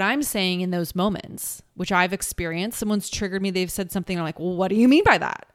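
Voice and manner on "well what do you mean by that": louder, quick defensive comeback; defensive mock retort. To someone who triggered her, a quick defensive louder snap. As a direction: snap back like you've been hurt.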